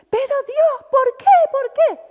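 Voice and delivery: high, loud pleading why; anguished crying out. Retold or imitated. Grieving man in the lift, imitated loud and high. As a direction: cry out in grief at God. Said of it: "él salía todo llorando".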